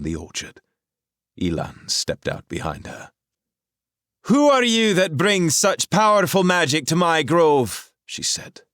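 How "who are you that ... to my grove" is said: high, loud commanding woman's voice; a sorceress demanding answers, loud and clear. A narrator's reading. Rahal challenges the intruders, high and commanding. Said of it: "her voice loud and clear".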